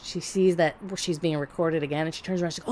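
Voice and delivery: ordinary delivery, nothing marked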